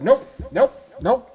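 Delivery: high, loud, repeated nopes; retold emphatic refusal, high and punchy; stubborn refusal. Retelling his stubborn refusal in studio.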